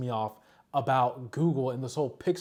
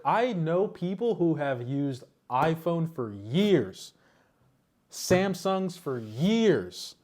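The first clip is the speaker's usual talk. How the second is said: slow, deliberate emphasis; slow emphatic; 2 semitones higher, much slower than usual. Real, not acted. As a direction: drag each word out to stress it. Slowed emphatic point.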